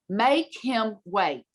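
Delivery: slow, drawn-out words; emphatic repetition. Retold or imitated. Headmistress repeating her order to baffled parents, imitated slow emphasis. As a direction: spell out the order at half speed.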